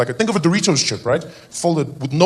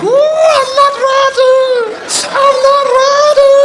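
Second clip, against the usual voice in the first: very high, loud singsong squeal; hammed-up falsetto wife; wife's coy wail; 9 dB louder, much slower than usual. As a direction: wail it slowly in mock fright. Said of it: "She's there like".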